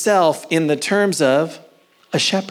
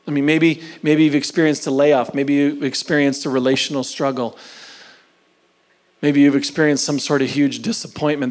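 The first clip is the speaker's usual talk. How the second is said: ordinary talk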